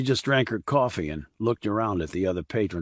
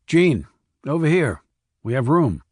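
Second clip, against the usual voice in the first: raised voice calling across a diner; 4 semitones higher, 5 dB louder than usual. Acted. Puller calls Jean over to their booth, raised voice. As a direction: call them over to the booth.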